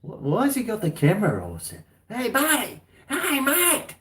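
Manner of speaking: high calling neighbour; mock neighbour calling out to him; neighbours' voices. Neighbour calling out to the man filming, high and mocking.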